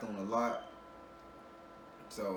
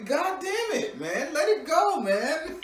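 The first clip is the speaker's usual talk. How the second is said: laughing loud and high, urging; cracking up while pushing her to let go; high laughing plea; 10 dB louder, much slower than usual. Real, not acted. Laughing, urging client to let loose.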